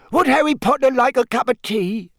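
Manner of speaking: loud, very high squeak; Dobby. A narrator's reading. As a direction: squeak it eagerly over the noise. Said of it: "he squeaked loudly over Winky's sobs".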